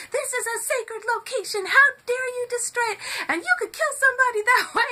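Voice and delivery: high, loud scolding mom; scolding mother yelling, fast at the end; shouting like a mom. Retold or imitated. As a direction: give them a mom's furious telling-off. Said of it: "that way that moms do".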